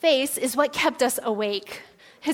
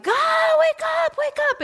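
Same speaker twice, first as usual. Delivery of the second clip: high, loud desperate cry to stay awake; high-pitched retold scream, exhausted and desperate; 6 dB louder than usual. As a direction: yell it out, pleading with God to keep you awake. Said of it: "like, screaming".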